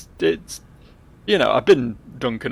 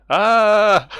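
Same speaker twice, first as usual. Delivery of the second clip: loud, high held "ah"; sudden loud, drawn-out yell; acted scream; 9 semitones higher, 8 dB louder than usual. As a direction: cry out as the character is struck. Character starts burning.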